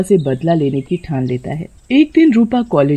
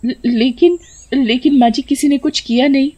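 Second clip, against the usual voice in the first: high stammering worry; frightened; 7 semitones higher than usual. A narrator's reading. Sheela asks mother-in-law in fear. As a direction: ask in alarm.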